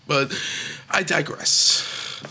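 very slow, grand I digress; drawn-out digress. Acted. Comedian changing topic, slow pompous I digress. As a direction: stretch it out pompously. Mock grand segue again.

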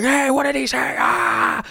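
very high shouting outrage; angry crowd shout. Pretend. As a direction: yell after him furious.

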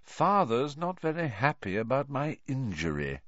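high, feigned regret; mock sorrow. A narrator's reading. Malfoy boasting about his injury, mock-sorrowful. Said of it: "in a tone of mock sorrow".

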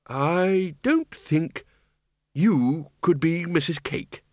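put-on dazed, drawn-out old zombie; slow zombie wizard. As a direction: speak slowly, a little bewildered.

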